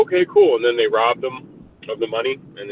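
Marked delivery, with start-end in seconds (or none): 0.1-0.6 high, loud casual okay cool; thug dudes. Retold or imitated. Thugs agreeing to sell Bruce Willis a gun, high breezy. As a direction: toss it off like a street guy. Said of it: "And they were like".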